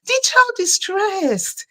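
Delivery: very high, exasperated retold lecture; high-pitched pleading reprimand; insistent reproach. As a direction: urge a stressed parent to calm down. Lecturing the father.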